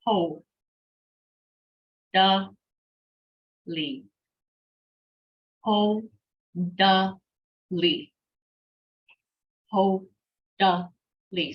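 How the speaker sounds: "ho", "da", "li", each alone with a pause; broken-up teaching of "ho, da, li"; syllable by syllable. Shown as a demonstration. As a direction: sound out ho ... da ... li slowly. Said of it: "So all together".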